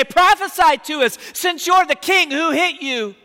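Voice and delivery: high, loud taunting sneer; mocking jeer. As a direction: jeer at him like a cruel soldier. Soldiers mock the blindfolded Jesus.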